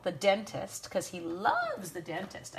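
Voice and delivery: dry, slow sarcasm on loves the dentist; sarcastic. Real, not acted. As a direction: say it like he adores the dentist, meaning the opposite. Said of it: "I'm being super sarcastic".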